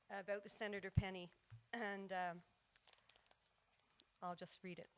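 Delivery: ordinary talk, nothing marked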